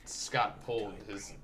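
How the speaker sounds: low, hushed narrator voice; quiet deadpan commentary; mock narration. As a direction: narrate softly and slowly, low in pitch. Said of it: "I'm gonna narrate now".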